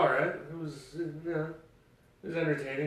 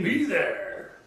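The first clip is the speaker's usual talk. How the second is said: high, loud announcer sign-off; monster truck announcer; 10 semitones higher, 8 dB louder than usual. Pretend. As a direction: punch it out like an arena ad. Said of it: "like, monster truck announcers".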